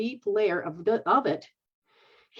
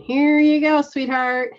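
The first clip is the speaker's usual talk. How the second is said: higher and louder, hand it over cheerfully; bright loud greeting; 6 semitones higher, 9 dB louder, slower than usual. Real, not acted. Handing over to Juanita, a loud cheerful greeting. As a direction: call it out to her.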